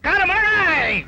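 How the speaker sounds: high, chanted street cry; high vendor's cry falling away; hawker's call. Acted. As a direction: call your wares down the street.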